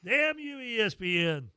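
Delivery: fake-curse the network while tearing up; mock exasperation. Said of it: "I'm like".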